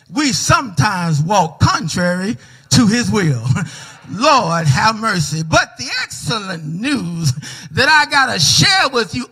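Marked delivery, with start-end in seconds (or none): none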